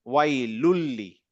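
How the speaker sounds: ওয়াই লুল্লি said slowly, with the noon merged into the lam; slow, careful recitation of ওয়াই লুল্লি with no noon; joined pronunciation. Shown as a demonstration. Teacher to student, slowly demonstrating ওয়াই লুল্লি with the merged lam. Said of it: "আপনি ওটা জুড়ে ফেলতেছেন".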